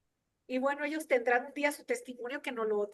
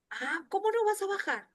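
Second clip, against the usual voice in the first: higher-pitched retold surprise; mild disbelief; 6 semitones higher than usual. Pastor hears the preacher won't come down, mock disbelief. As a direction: imitate a pastor taken aback, pitch lifted. Pastor reacts to husband's refusal.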